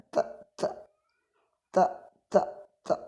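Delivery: ta with a strong puff of air, almost unvoiced; aspirated ta. Shown as a demonstration. Teacher shows students ta must release air, soft and airy. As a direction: breathe out hard on each ta. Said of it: "Yang tepat harus mengeluarkan udara".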